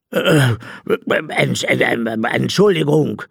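startled, halting apology, pitch jumping up; stumbling over the word in fright; frightened stammer. A narrator's reading. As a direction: stammer it out, shocked. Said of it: "stammelte er selbst zu Tode erschrocken".

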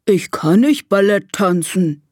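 lightly voiced elephant protest; tooting elephant. Elephant Gustav doubting he can dance, lightly tooted. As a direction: object in a slightly put-on elephant voice. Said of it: "trötet er".

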